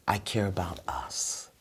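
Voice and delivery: soft whisper, sincere; whispered I care. Shown as a demonstration. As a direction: breathe it gently to your partner. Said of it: "you wanna speak slow".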